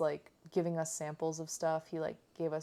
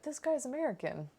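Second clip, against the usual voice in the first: surprised; 8 semitones higher than usual. Retold or imitated. Friends react to American owner.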